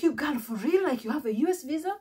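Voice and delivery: can't believe she has a visa; amazed disbelief. Retold or imitated.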